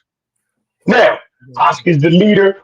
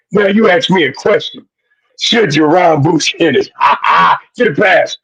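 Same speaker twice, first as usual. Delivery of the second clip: ordinary talk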